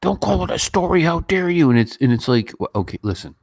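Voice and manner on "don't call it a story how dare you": high, indignant mock outrage; hammed-up indignant scold; mock offended critic. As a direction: play the offended fan, pitched way up. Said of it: "they'll be like".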